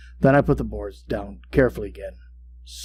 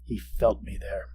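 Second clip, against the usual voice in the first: hushed dread; 5 semitones lower, 11 dB quieter than usual. A narrator's reading. Dread, death in the room.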